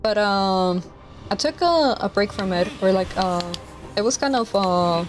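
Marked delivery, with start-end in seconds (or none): none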